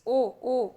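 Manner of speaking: ओ, ओ held as an open rounded English o; rounded o demo. Shown as a demonstration. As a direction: stretch the ओ sound clearly. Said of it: "इसको आगत की श्रेणी में रखा गया".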